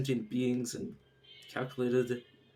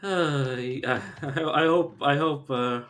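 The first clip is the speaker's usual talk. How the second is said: hammed-up posh lament, louder and higher; mock sad lament; 4 semitones higher, 9 dB louder, slower than usual. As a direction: wail it like a tragic posh gent. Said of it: "you could sense the sadness in my voice".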